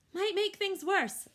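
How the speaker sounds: a cautious elder elephant's warning; cautioning. A narrator's reading. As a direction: warn gently but firmly. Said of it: "Akello cautions".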